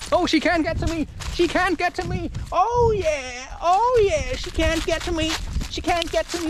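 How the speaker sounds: high, repetitive mock whine; mock panicked striver. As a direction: imitate someone frantic, high-pitched and chanting. Mimics people straining against limits.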